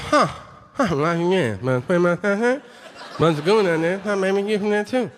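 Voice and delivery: garbled Cajun gibberish; unintelligible Cajun. Retold or imitated. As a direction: imitate a Cajun swallowing every consonant. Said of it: "Are you a person that's talking to me".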